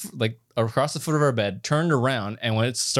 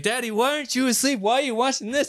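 much higher mock little girl asking; daughter's voice; 12 semitones higher than usual. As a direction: pitch way up like a sleepy five-year-old. Father quoting sleepwalking daughter.

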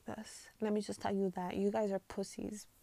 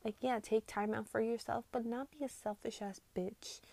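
ordinary delivery

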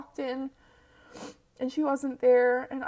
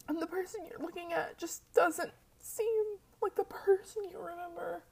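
tearful, breathy whisper; barely holding it together; snotty crying; 5 semitones higher, 5 dB quieter than usual. Real, not acted. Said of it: "normally I can't talk while I'm crying".

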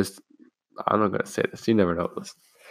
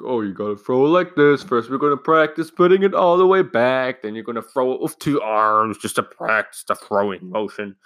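raised mocking demo voice, peaking then settling; instructing teacher; 4 semitones higher, 3 dB louder than usual. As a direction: imitate an over-eager coach explaining technique.

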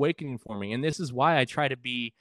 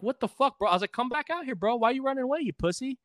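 high, taunting mock challenge; taunting yell; 11 semitones higher than usual. Teen taunting a band member walking away, mock yell. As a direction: call him out, pitched way up. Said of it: "I'm like".

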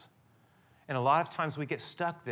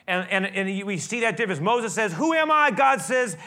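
high and loud, pounding sermon voice, much higher and louder; preaching at full force; 8 semitones higher, 9 dB louder than usual. Acted. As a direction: drive it home to the congregation. Preaches Moses and God story.

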